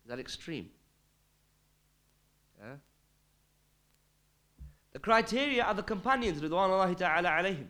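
ordinary delivery

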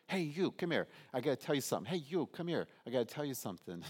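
high, eager shout to passers-by; excited calling out. Retold or imitated. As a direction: call out to strangers, bursting with news. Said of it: "You can just see her".

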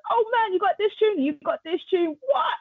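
high-pitched amazed enthusiasm; imitating an excited music fan, pitch up; retold excitement. DJ browsing the library, high excited delight.

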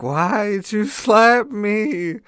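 high and slow, a put-on groggy voice; mock bewildered whine; dazed indignant. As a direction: play it woozy and wronged just after waking. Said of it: "you're like".

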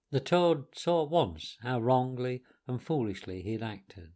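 usual speaking voice

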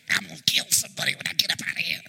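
fast whispered grumble; grumbling Lazarus. Pretend. Lazarus rising from the grave, whispered fast grumble. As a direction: mutter it like a mummy fed up.

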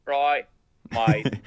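German speaker trying an Aussie accent; tentative imitation of Australian speech; attempted Australian accent. Shown as a demonstration. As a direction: have a short go at sounding Australian. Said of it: "Well, repeat after me".

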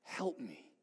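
soft, exhausted "Help me."; barely a breath of prayer; worn-out quiet plea; weak, spent plea. Retold or imitated. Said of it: "that's all we can say".

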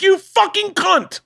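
high-pitched retold outrage; hammed-up furious screech, words stretched out; losing it. Rider fighting over a bike, losing it. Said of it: "I lose it".

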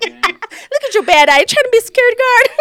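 high, loud jeering; hammed-up high-pitched insult; mock taunt. Jokey taunt at the bouncer.

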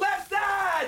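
high-pitched team chant yell. Acted. Runner rallying the group up the mountain, yelling. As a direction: holler it as a rallying cry. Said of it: "what are you yelling".

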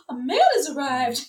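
gasping, high and loud Southern belle alarm; hammed-up Southern accent, shrieked in mock panic; terrible Southern accent. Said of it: "doing a terrible Southern accent".

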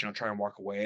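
usual speaking voice

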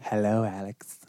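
low, soft sinister greeting; creepy Hannibal Lecter voice. Retold or imitated. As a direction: lean in close and menace. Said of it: "Did I just scare you?".

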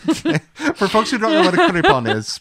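real laughter in the voice; laughing. Real, not acted. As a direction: ask it while cracking up. Amused host breaks up at guest.

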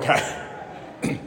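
whispered, half-laughing okay; meek whisper. Retold or imitated. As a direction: give in sheepishly under your breath. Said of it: "And you're like".

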